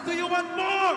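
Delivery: loud teasing shout; shouted repeat. Acted. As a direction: yell it at the crowd again. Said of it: "I said".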